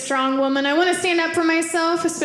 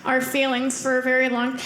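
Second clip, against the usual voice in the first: normal talking voice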